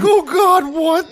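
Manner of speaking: high, loud mock alarm; dad upstairs hearing the news, high alarm. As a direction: squeak it out like a startled drunk dad. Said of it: "He's like".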